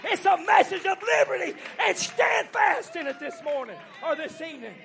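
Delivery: loud, rising sermon cry to the church; fiery revival preaching; full-force preaching. Acted. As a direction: preach it out, building to the climax.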